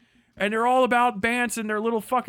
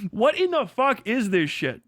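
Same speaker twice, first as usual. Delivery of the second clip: ordinary talk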